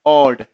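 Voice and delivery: ऑड said loud with an open o; ऑड demo. Shown as a demonstration. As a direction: stress the open o in ऑड. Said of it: "इसको हम क्या read करेंगे?".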